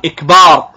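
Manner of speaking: "إكبار" starting with a short i, then long "baar": "ikbaar"; kasra on the hamza. Shown as a demonstration. Teacher quoting a faulty takbir, "إكبار" begun with i. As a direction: open "إكبار" on an i sound, stretch the baa. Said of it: "بكسر الهمزة".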